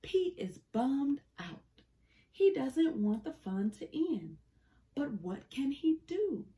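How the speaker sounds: usual speaking voice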